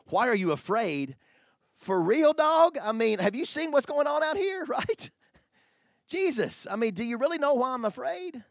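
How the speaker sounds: high, loud, incredulous street-talk protest; mock disbelief. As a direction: squeal it like a panicked disciple. Disciples' outraged reply, mock.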